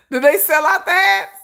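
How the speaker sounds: usual speaking voice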